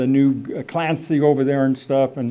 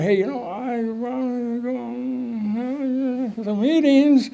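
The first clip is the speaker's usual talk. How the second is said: slow, higher, soft and hesitant; timid request; 6 semitones higher, 4 dB quieter, slower than usual. Retold or imitated. Rob calling the hotline, slow and timid. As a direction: ask the hotline shyly. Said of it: "and said".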